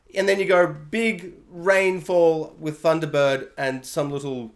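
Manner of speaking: normal talking voice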